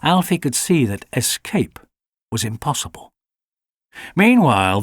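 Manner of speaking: ordinary delivery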